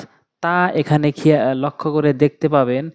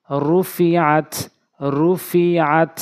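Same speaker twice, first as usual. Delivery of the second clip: slow joined reading; much slower than usual. Shown as a demonstration. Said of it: "slow joined-reading demo".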